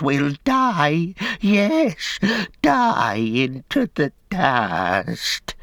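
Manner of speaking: high, slow, wailing creature voice; whimpering Gollum. A narrator's reading. Gollum begging Sam to spare him, high wailing whine. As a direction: cringe and wail for mercy.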